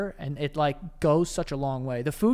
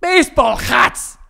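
loud, slow put-on accent on "Baseball hats"; mock Yiddish-style accent; 7 semitones higher, 11 dB louder, slower than usual. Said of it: "Take it easy there".

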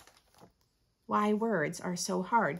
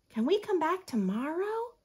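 put-on higher, pleading child's question; hopeful little girl; 7 semitones higher than usual. Girl begging her mother to return, higher hopeful voice. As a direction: ask mommy hopefully.

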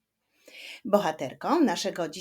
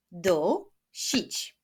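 "Dusić" with a clear gap between syllables; split into syllables. Shown as a demonstration. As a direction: clap out "Dusić" beat by beat. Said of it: "Wyklaszczmy sylaby w wyrazie".

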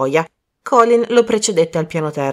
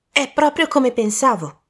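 put-on higher, urgent young man's voice; a worried youth bursting in; young man Colin; 8 semitones higher than usual. A young man waking Poirot, raised and anxious.

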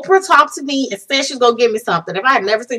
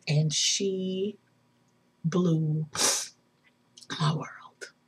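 very soft and low, fading to a whisper; overwhelmed hush; 6 semitones lower, 14 dB quieter, much slower than usual. Real, not acted. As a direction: let it trail away in awe, with long pauses. Overwhelmed, ending the story softly.

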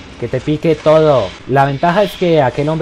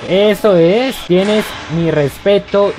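higher and much louder, celebrating; excited cheering; 6 semitones higher, 4 dB louder than usual. Real, not acted. Player celebrating a win with a game buddy, loud excited cheering. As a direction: cheer it out in triumph.

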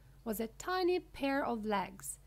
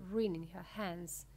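ordinary delivery, nothing marked

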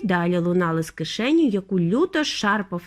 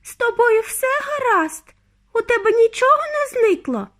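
very high, anxious motherly cry; frantic high questioning; exclaiming mother; 5 dB louder than usual. A narrator's reading. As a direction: cry out in high-pitched worry. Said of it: "вигукнула пані Т В".

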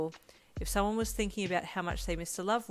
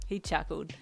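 ordinary delivery, nothing marked

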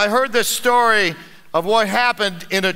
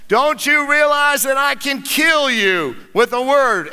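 menacing Satan shout; 4 semitones higher than usual. Acted. Satan shouting his threat at the old man. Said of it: "asked Satan".

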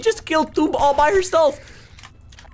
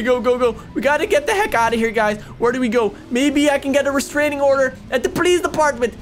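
ordinary delivery

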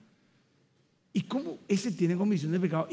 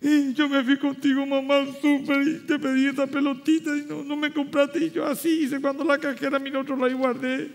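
put-on child's confession; 6 semitones higher than usual. Child confessing a petty theft.

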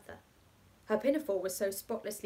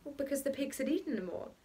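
quick, ready bachelor reply; a touch of a brisk bachelor; prompt answer; 4 semitones higher than usual. A narrator's reading. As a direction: answer without missing a beat. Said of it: "said the bachelor promptly".

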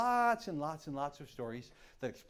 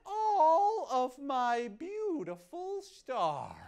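high, slow crying longing; wistful coyote; 6 dB louder, slower than usual. Acted. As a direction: mourn the stars he lost. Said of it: "and he says".

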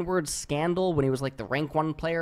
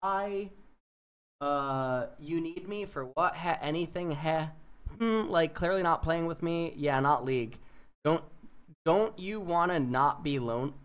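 quiet; 6 dB quieter than usual. Retold or imitated. Reading creepy messages, dropping volume.

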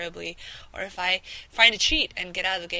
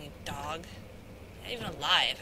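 ordinary talk, nothing marked

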